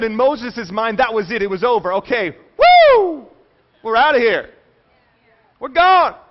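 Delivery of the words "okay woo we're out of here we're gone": loud, high cheer of relief; jubilant whoop. Acted. Moses cheering to his people as they leave Egypt, a loud, high whoop of joy. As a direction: whoop it up like you're finally free.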